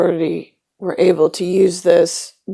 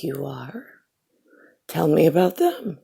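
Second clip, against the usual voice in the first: put-on much softer, hushed and small, as a curious kid; quiet child voice; 3 semitones lower, 8 dB quieter than usual. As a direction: ask it almost under your breath, like a child. Child quietly answering uncle's boast.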